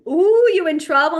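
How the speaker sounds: loud, high playful ooh; playful mock gasp tease; teasing. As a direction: gleefully tease the kid in trouble. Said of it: "I'm like".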